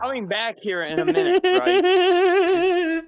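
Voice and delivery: rising alarm. Acted. Player warns incredulously, in character.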